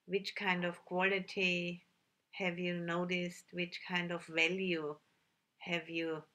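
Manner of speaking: calm meditation guide with long, unhurried pauses; measured, settled voice, leaving silence between instructions; guided meditation. Real, not acted. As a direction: gently lead sitters inward, phrase by phrase.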